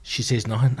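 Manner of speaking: ordinary talk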